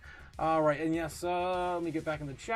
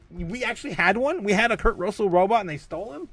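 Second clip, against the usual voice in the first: higher and louder, hammed-up shock; mock disbelief, raised voice; mock outrage; 6 semitones higher, 11 dB louder than usual. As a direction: play it like a theft just happened. Shocked at robbed robot joke.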